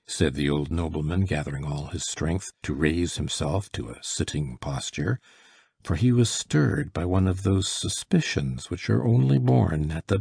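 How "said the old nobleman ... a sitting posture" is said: plain reading voice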